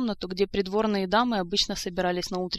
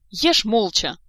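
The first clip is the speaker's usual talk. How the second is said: loud scolding order; acted exasperated outburst; exclaimed rebuke; 3 semitones higher, 9 dB louder, much slower than usual. A narrator's reading. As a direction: shut the chatterer up sharply. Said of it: "хором воскликнули все".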